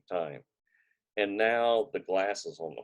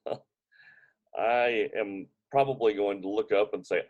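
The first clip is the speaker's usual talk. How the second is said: normal talking voice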